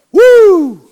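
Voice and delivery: very high, loud "woo"; excited whoop. Real, not acted. Preacher reacting to fire falling from heaven, excited whoop. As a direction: let out a piercing pulpit whoop.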